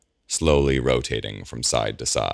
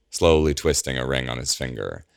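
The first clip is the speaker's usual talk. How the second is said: plain reading voice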